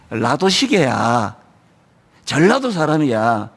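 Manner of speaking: low, slow confiding aside; imitated gossiping pastor. Pastor whispering gossip to him. (낮고 느린 뒷담화 말투)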